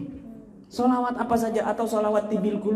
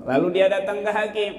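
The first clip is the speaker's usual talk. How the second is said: usual speaking voice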